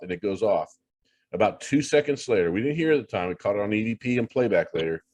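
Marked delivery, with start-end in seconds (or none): none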